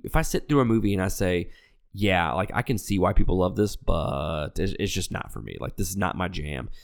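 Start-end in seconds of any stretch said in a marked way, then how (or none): none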